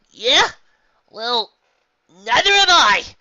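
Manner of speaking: high, loud, slow competitive shout; loud boast. A narrator's reading. Competitive boast between ponies.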